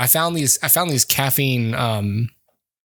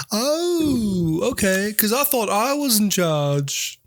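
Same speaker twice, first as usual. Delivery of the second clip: 1 Paul; 7 semitones higher, slower than usual. Retold or imitated. Voicing Paul arguing with John.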